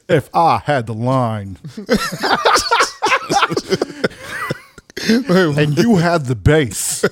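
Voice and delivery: slow, over-proper rap imitation; mock proper rapper. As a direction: tease a rapper's prim delivery, drawn out. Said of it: "you sound real proper".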